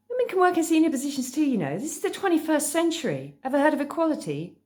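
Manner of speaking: high, indignant schoolgirl; bristling. A narrator's reading. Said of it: "Mo bristled".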